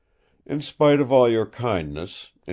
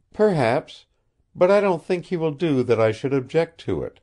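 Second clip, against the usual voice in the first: put-on earnest high young woman, pleading and honest; lightly voiced heroine, innocent and earnest; young woman's voice; 9 semitones higher, 5 dB louder than usual. A narrator's reading. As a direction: speak like a puzzled, sincere girl.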